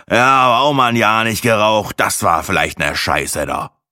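show-off figure, loud and high at first, settling; bragging smoker. A narrator's reading. Show-off smoker recalling a year without cigarettes, grumbling. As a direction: grumble like a braggart after a drag.

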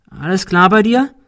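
high, rising call. A narrator's reading. Cecil calls up to Ben on the stairs, calling out. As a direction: call up the stairwell to check on a friend. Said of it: "rief Cecil".

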